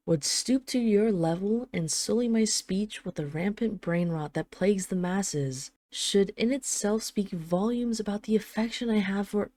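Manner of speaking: ordinary talk, nothing marked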